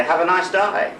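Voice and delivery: a cheery Australian farewell, lightly done; Australian accent. Retold or imitated. Guest signing off with an Australian phrase, mild Australian accent. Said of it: "as they say here in Australia".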